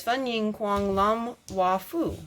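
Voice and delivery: slow, careful reading of Chinese words; reading Chinese sign. Shown as a demonstration. Reading the Chinatown sign aloud to a child, slow and careful. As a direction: sound out the sign syllable by syllable, slowly. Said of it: "It says".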